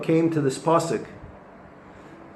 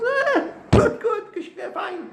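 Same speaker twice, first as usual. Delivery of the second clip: a much higher, laughing "Ah, good, good, fine"; cheerful Rabbi Akiva; slower than usual. Retold or imitated. Rabbi Akiva laughing at foxes on the Temple ruins, cheerful high voice. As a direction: sing out the words as if delighted, ending in a laugh.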